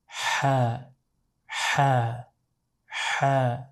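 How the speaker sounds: Ha said with a soft airy h breathed out from the back of the throat; Ha, an exhaled breathy h then a short a. Shown as a demonstration. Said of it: "represents a very breathy H sound".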